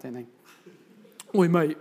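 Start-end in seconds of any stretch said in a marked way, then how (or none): 1.3-1.8 louder mock workman's call. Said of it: "Just joking".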